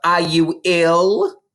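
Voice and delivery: put-on a stern mother waking her child; mother's voice.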